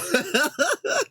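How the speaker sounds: giggling high laugh; real high giggle. Real, not acted. Laughs after "let me stop.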